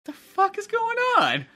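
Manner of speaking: very high, slow disbelief; baffled squeal of a question, drawn out; bewildered. Retold or imitated. Storyteller stunned by a stranger's flying kick, bewildered. Acting out his baffled reaction.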